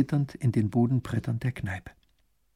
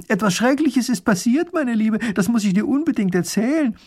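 high, loud call from the window; 10 semitones higher, 6 dB louder than usual. Acted. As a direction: shout it across to the neighbour. (laut aus dem Fenster gerufen)

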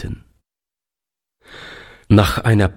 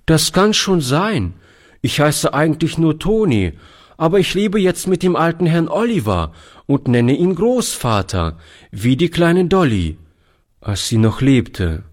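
put-on a touch higher, eager young boy; boy Toni explaining to the stranger, higher pitched; lightly voiced boy; 7 semitones higher than usual. Boy telling soldier about Dolly, lightly higher boy voice.

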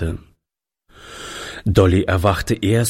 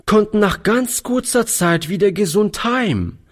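put-on boyish, higher and hopeful; young boy voice; 9 semitones higher than usual. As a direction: speak as an eager young boy. Boy comforting, high voice.

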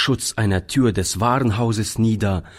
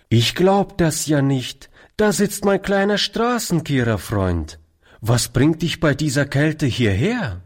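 higher-pitched, amazed stranger's call; surprised greeting; 6 semitones higher than usual. A narrator's reading. Stranger finds his little street-sweeper friend in the cold, surprised greeting. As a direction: voice lifted on finding a little friend. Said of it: "die ihm bekannt vorrief".

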